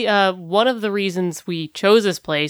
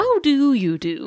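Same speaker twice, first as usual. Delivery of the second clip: high mock British politeness; overly polite English greeting; posh British greeting; 8 semitones higher than usual. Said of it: "it's like saying".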